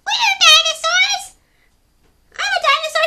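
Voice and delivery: very high, loud squeaky tiny dinosaur; a tiny creature proudly calling out; little creature. Acted. Reader voicing little scuttling dinosaur answering Pipkin. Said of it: "said one little creature".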